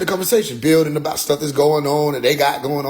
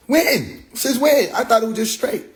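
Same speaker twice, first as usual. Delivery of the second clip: mock shock; 8 semitones higher, slower than usual. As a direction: act shocked. Retold shocked questions.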